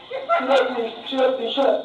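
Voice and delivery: curt Long Island husband; Long Island accent. Acted. Fed-up husband silencing Denise, curt Long Island accent. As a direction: cut her off in a Long Island bark. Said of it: "they were talking like this".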